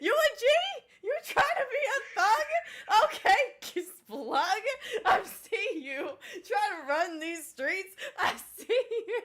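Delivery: loud, high, laughing through the words; laughing teasing. Real, not acted. Player laughing at the goblin trying to be a thug. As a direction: tease him while cracking up.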